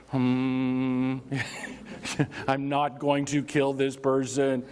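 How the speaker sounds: hum, then a steady monotone mantra; mock zen calm. Speaker to self about rising above it, mock zen calm. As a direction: talk yourself down in an even chant.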